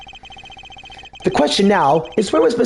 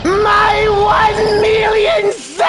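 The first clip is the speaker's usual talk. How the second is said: anguished shout; much slower than usual. Acted. Character loses it over money.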